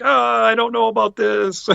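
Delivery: high, slow, doubtful voice as his wife; hesitant wife. Retold or imitated. Wife hesitant about meet-and-greet.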